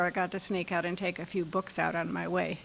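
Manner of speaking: normal talking voice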